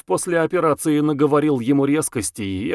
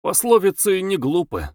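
mildly authoritative correction, pitched up; understated air of authority; 8 semitones higher than usual. A narrator's reading. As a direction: state it as the one who knows. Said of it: "авторитетно заявил Самгин".

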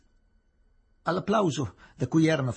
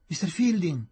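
higher, louder cry of his name; desperate cry; 7 semitones higher, 3 dB louder than usual. A narrator's reading. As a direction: call after him as he leaves. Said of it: "gridò la voce rotta di Aziz". (grido disperato, voce rotta)

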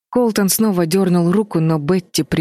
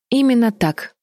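higher-pitched, curt reply; sharp retort; 7 semitones higher than usual. A narrator's reading. Betty answers Colton's suspicion, sharply. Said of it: "резко ответила Бетти".

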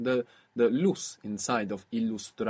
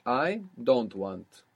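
I don't want, said very slowly; slow prompt; much slower than usual. Shown as a demonstration. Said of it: "The same order as English".

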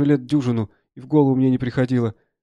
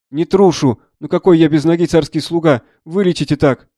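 loud, higher, insistent plea; protesting soldier; 4 semitones higher, 8 dB louder than usual. Acted. Soldier denying cowardice before amputation, loudly protesting. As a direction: protest hotly, then beg.

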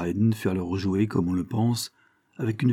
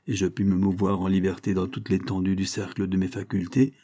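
usual speaking voice

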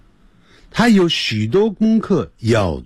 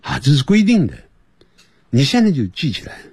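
ordinary talk, nothing marked

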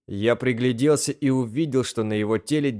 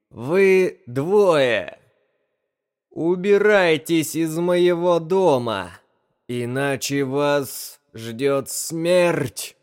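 loud, slow, higher gurgling threat; 5 semitones higher, much slower than usual. Acted. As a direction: gargle the words like a mouth full of water. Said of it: "громким булькающим голосом". (громкий булькающий голос)

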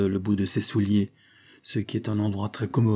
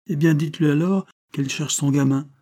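higher-pitched, triumphant comeback; crowing a little, voice raised in pitch; triumphant retort; 7 semitones higher, 4 dB louder than usual. A narrator's reading. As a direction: throw it back as a winning retort. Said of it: "rétorqua triomphalement Beru".

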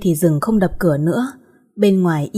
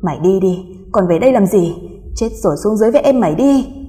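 louder, terrified order to go away; frightened shout; 4 dB louder than usual. Acted. Woman driving the ghost from her door, frightened shout. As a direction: yell at the ghost out of fear. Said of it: "Cô ngập ngừng".

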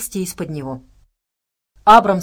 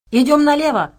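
raised, higher-pitched shout; shouted command; 7 semitones higher, 6 dB louder than usual. A narrator's reading. Nell shouting directions to companions on the run, shouted. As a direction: call out urgently over the group. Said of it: "прокричала Нелл".

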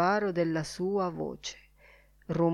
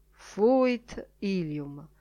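plain reading voice

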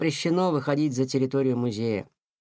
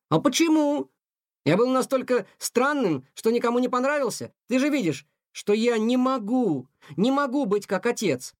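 put-on upset young cat; 7 semitones higher than usual. A narrator's reading. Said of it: "возмутился Тихон".

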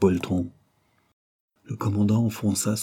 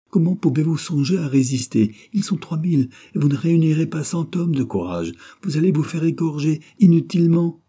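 high, raised, alarmed remonstrance; desperate pleading; 8 semitones higher, 5 dB louder than usual. A narrator's reading. Wife begging commander not to resist, high and desperate. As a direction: plead against a hopeless fight, growing louder.

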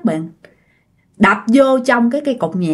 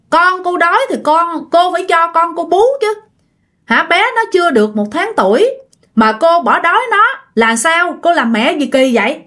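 doctor's indignant, higher-pitched telling-off; reproachful doctor, raised pitch; acted scolding; 7 semitones higher than usual. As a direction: scold a negligent mother in disbelief. Doctor scolds a neglectful mother.

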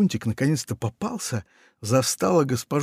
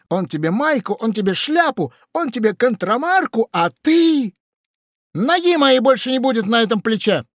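higher, loud, building indignant parrot; 11 semitones higher, 5 dB louder than usual. A narrator's reading. Parrot scolds the pirate for betraying Funtik, building loud. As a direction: scold him, rising in outrage.

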